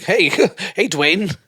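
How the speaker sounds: high, loud mock greeting with a laugh; mock hailing. Co-host teasing with an acted greeting, high and loud. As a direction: call out to someone, teasing.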